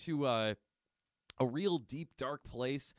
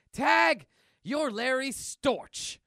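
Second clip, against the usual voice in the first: loud taunt; 10 semitones higher, 9 dB louder, much slower than usual. Acted. Villainous comic character taunts.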